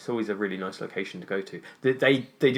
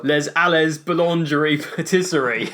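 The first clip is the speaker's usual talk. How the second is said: heavily anglicized French, loud and raised; Brexit voice; 5 semitones higher, 6 dB louder than usual. Shown as a demonstration. Host reads a French bakery name, butchered British-accented French. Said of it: "that's the Brexit voice there".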